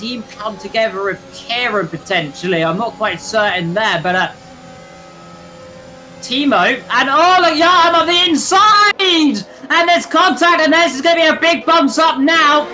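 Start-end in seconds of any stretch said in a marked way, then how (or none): 6.2-12.7 excited commentary. Real, not acted. Crash unfolding in live commentary.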